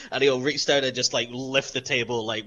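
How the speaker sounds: ordinary talk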